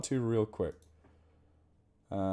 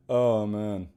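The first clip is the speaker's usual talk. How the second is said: usual speaking voice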